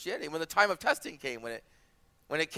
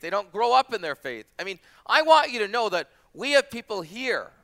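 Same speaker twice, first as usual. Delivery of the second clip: loud, raised-pitch scripture exposition; emphatic preaching; 4 semitones higher, 7 dB louder than usual. Real, not acted. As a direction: proclaim the warning to the congregation.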